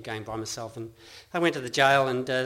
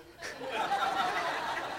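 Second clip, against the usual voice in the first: quiet laugh; 3 dB quieter than usual. Real, not acted. Laughs at own story.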